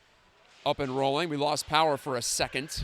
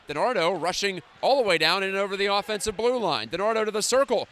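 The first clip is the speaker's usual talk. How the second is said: building excitement; 6 semitones higher, 6 dB louder than usual. Acted. Rush toward goal.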